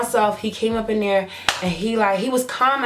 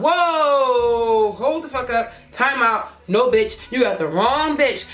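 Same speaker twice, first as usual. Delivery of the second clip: retold stunned objection, higher, drawn-out whoa; indignant protest; 4 semitones higher, slower than usual. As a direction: act out cutting someone off in disbelief. Acted protest to the accusing woman.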